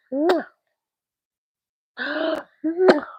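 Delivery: high gasp, then a loud smacking kiss. Real, not acted. Mother kissing her baby: gasp and smacking kiss.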